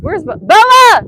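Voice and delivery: loud call across the yard for the dog; loud searching call. Real, not acted. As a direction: shout out to find her. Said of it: "sorry for yelling in the mic".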